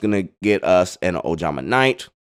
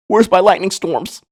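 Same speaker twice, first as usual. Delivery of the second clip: much higher, louder mock outcry; mock frustrated cry; 10 semitones higher, 6 dB louder than usual. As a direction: wail it like a frustrated duelist.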